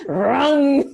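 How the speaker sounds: loud, high engine noise; motorbike rum. Retold or imitated. Speaker imitating a passing motorbike, loud and laughing. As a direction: rev it like a motorbike.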